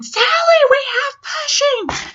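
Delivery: high, loud drawn-out scream; imitated urgent shout across the house. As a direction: yell it out high and long, calling for help.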